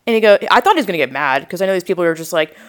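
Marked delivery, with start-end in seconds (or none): none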